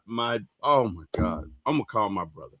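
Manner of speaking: normal talking voice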